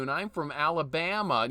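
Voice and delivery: normal talking voice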